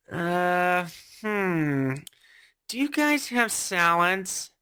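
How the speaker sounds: drawn-out hesitant dithering; indecisive customer. Acted. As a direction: hum and haw before asking. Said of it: "there's indecisive Susan".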